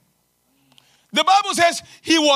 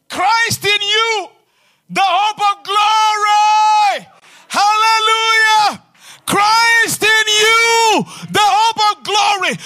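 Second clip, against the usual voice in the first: loud, slow, drawn-out proclamation; thundering sermon declaration; full-force preaching; 5 semitones higher, 4 dB louder, slower than usual. Acted. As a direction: ring it out over the congregation. Preacher building to shouted climax.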